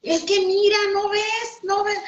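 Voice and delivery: insistent husband. Retold or imitated. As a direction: push him to look, high and eager. (esposo insistiendo que mire, voz mucho más aguda)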